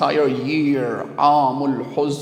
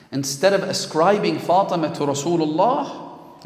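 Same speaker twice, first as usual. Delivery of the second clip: ordinary talk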